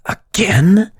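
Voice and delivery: high, surprised outburst; jolted awake and annoyed; startled exclamation. Acted. Waking to the siren, a startled exclamation.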